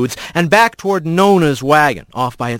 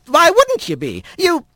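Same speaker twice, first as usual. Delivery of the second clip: put-on old squirrel grandmother. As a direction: coax him like a fond old granny. Grandmother alarmed.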